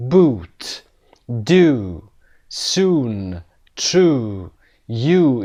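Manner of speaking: "boot، do، soon، true، you" each with a drawn-out oo; "boot، do، soon، true، you" as a spaced word list, each oo held long; long oo vowel. Shown as a demonstration. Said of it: "اینها همه صداهای اوی کشیده هستن".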